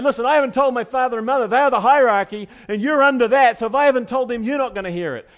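put-on a brash young husband putting his bride in her place; Samson laying down the law. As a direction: rattle off his rank like an order. Preacher paraphrases Samson's stance.

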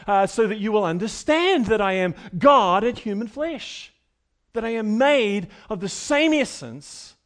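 Jesus confronting his accusers, emphatic and forceful. Acted. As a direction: push hard into the crowd. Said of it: "Jesus is emphatic here. He's forceful".

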